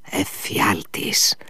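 slow, whispered dread; the commander whispers Εφιάλτης in horror; hoarse whisper. A narrator's reading. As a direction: breathe the word out slowly. Furious old commander.

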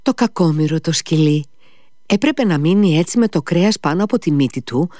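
ordinary delivery

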